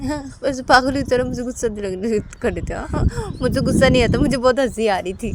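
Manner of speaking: defiant Uma. Acted. Uma denying to Sanju she was angry, mild loud protest.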